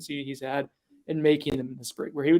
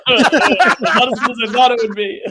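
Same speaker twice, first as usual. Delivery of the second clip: laughing; 8 semitones higher, 11 dB louder than usual. Real, not acted. Laughing at the stats chart.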